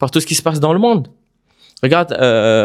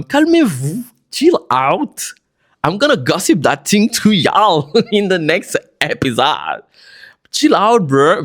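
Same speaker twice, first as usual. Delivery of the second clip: playful; 6 semitones higher, much slower than usual. Pretend. Teasing calming down listeners.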